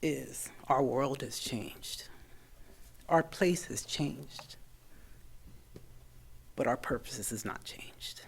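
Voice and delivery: slow, solemn preacher cadence, sinking to a whisper; slow pastor's words, last line whispered; preaching. Retold or imitated. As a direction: deliver it like a Sunday sermon. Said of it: "I'm gonna borrow words from my pastor on Sunday".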